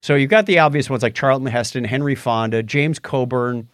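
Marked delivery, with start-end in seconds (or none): none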